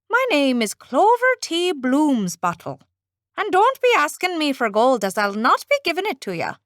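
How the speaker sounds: lilting Irish leprechaun, quick; a cheeky leprechaun warning off; Irish accent. Acted. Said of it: "A leprechaun".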